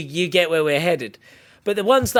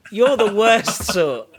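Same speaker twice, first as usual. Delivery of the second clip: mock scolding; 4 semitones higher, 4 dB louder, slower than usual. Mock telling-off repeated.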